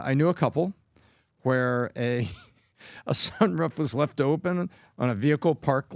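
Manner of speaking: ordinary delivery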